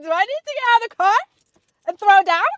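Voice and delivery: joking she'll get out and fight the neighbors, loud and fast; joking threat. Pretend. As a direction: yell it like a playful fight challenge. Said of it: "Just kidding".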